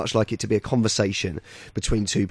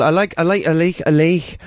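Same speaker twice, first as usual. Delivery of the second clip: light Geordie "I like" with a flat leɪk vowel; [name] Geordie; 3 semitones higher than usual. Retold or imitated. Geordie Cheryl impression asked for by host, light. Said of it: "no, Cheryl".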